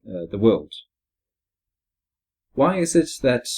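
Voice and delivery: ordinary talk, nothing marked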